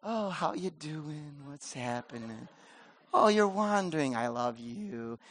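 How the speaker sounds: slow, soft, over-sweet greeting; mock sugary approach. Pastor mocking a timid approach to a wanderer, soft and syrupy. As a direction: tiptoe around it with fake sweetness. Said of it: "don't kinda like".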